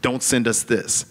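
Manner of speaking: ordinary delivery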